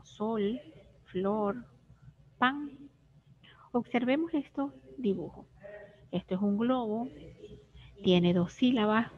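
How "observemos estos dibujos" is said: ordinary delivery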